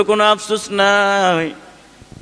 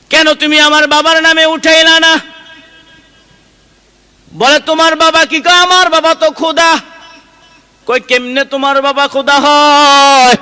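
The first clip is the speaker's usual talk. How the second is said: high-pitched, impassioned sermon delivery; high, projected waz storytelling; full-force preaching; 9 semitones higher, 5 dB louder than usual. Acted. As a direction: act out the argument in a raised preacher's chant. Preacher acts out a heated exchange.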